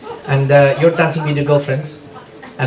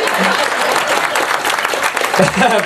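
breathy laugh; 4 semitones higher than usual. Real, not acted. Laughing at own bit.

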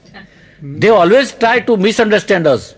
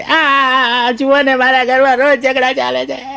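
put-on high, slow wailing kid voice; hammed-up tearful whine; crying child; 6 semitones higher, much slower than usual. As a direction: bawl the words like a small child. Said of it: "નાનું છોકરું સીડીએથી રડતું રડતું".

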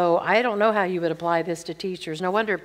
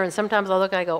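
normal talking voice

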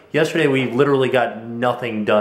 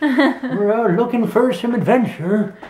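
slow, lightly voiced old prospector; a hint of a drawling gold miner; 10 semitones higher, slower than usual. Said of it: "I was trying to do, like, I'm an old prospector".

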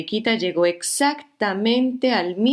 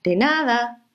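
higher, cheerful ladybug voices; two ladybugs; 5 semitones higher than usual. Acted. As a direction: answer together like ladybugs. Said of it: "respondieron las dos mariquitas".

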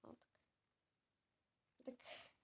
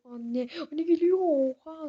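higher, loud alarmed cry; alarmed shout; 4 semitones higher, 10 dB louder than usual. Real, not acted. Kid warning his friends the mom is coming fast, shouting. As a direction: yell the warning as she charges.

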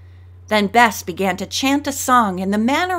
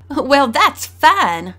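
laughing; 4 semitones higher, 5 dB louder, much slower than usual. A narrator's reading.

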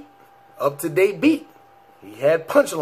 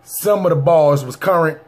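normal talking voice

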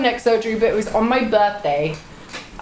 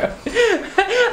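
high burst of giggles; high laugh; 11 semitones higher than usual. Real, not acted.

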